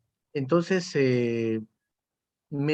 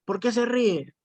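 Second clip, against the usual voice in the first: higher, sharp mock reprimand; stern teacher; 7 semitones higher, slower than usual. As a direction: question the pupil like a strict teacher. Teacher reprimanding a laughing pupil. (profesor severo, imitado con tono más alto)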